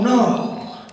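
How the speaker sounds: ordinary talk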